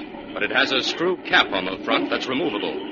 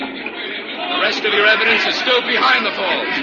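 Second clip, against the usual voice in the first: loud, higher explanation called over the roar; calling out; 14 semitones higher, 6 dB louder than usual. Acted. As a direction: keep projecting over the water. Explaining loudly over the falls.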